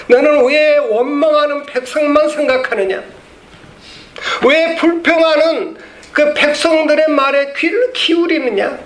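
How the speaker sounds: God scolding Moses for heeding the grumbling people; God's rebuke. Acted. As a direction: rebuke him from above, high and insistent. Preacher voices God rebuking Moses.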